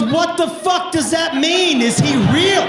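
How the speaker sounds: high, loud, slow outraged yell; loud, high shocked outburst; disbelieving shout. Acted. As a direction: yell it at your clueless husband. Wife yells back in disbelief.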